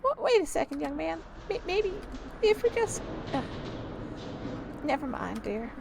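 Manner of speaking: high, halting granny voice, dropping off at the end; put-on faltering old-lady voice; hesitant old lady. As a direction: stammer a request as an old woman, then give up. Old tenant stops the landlord.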